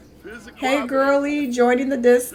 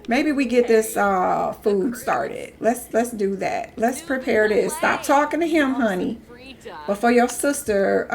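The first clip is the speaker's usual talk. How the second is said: usual speaking voice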